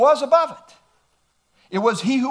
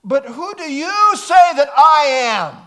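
loud, high challenging question; Jesus presses the disciples loudly; ringing question; 4 semitones higher, 7 dB louder than usual. Acted. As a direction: call it out to the disciples. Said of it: "Jesus said to the disciples".

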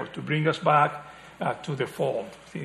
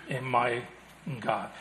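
hushed reverent close; 4 semitones lower, 8 dB quieter than usual. Acted. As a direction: fade to a near-whisper on "God". Echoing Thomas's confession.

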